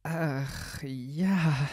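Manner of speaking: high, drawn-out sigh; sighing princess. Acted. Princess gives in to the frog, high sighing. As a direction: agree with an airy girlish sigh.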